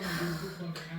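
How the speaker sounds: long, soft exhale; exasperated sigh. Retold or imitated. Fed up with still-crying cousin, weary sigh. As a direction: let out a worn-out sigh. Exasperated reaction in retold scene.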